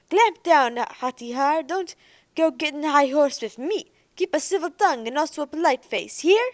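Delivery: loud, high, bullying commands; menacing order. Acted. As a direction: warn him off like a street tough. Thug threatens Derek.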